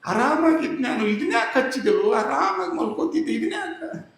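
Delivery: put-on high, thin old woman complaining; old woman's grumble. Old woman scolding Sharif for the pot, high whining. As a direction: whine like a granny roused from sleep.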